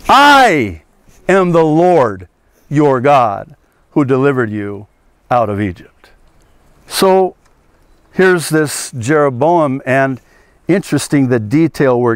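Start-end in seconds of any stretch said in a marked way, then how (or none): 0.1-6.1 God declaring it with a punched "I"; emphatic divine I. Acted. Preacher voicing God, stressing "I" over the calf. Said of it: "what God is saying is".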